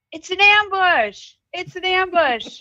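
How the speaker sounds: high, loud cry of alarm; screamed warning. Acted. Player has Rhea warn everyone of the ambush, screamed out. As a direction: shout it out to warn the whole party. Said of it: "Rhea would scream out".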